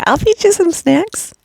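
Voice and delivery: squeaky high cat voice, lightly done. Retold or imitated. Imitating the pet cat begging, high and cute.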